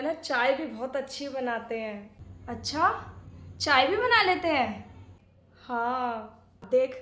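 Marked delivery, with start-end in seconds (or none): 2.5-4.8 astonished question. Acted. Bahu learns uncle makes tea too, astonished question. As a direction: ask it in open amazement.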